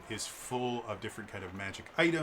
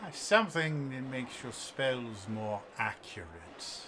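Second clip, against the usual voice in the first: put-on elderly elf shopkeeper. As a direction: linger on each word, savoring the wares. Game master voices wand seller.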